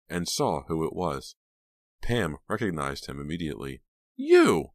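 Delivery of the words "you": very high, loud exclamation; shocked cry. A narrator's reading. Pam recognizing her attacker, shocked cry. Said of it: "she cried".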